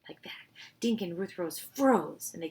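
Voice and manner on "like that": unvoiced hushed reading; whispered narration. A narrator's reading. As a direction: build suspense in a whisper. Tense hiding scene.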